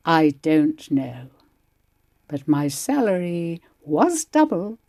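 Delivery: ordinary delivery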